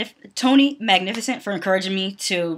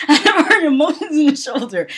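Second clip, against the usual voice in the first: louder, higher mock quote; mocking repeat; 6 semitones higher, 7 dB louder than usual. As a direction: laugh at the botched saying as you repeat it. Repeats the botched saying, mocking it.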